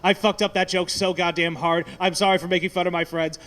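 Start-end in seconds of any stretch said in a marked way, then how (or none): none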